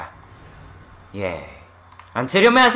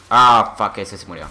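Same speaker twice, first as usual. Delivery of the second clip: ordinary talk, nothing marked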